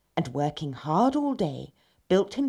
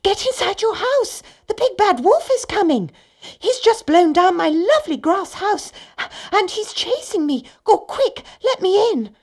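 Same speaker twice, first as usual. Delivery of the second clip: very high, loud, frantic piggy voice; panicked little pig, pitched right up; breathless cry; 9 dB louder than usual. A narrator's reading. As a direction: cry out a desperate warning as a fleeing pig. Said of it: "he cried, out of breath".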